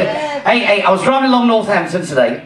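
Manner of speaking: ordinary delivery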